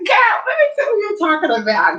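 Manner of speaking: high, eager shout; excited. Retold or imitated. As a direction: push eagerly for the pictures. Retold eager request.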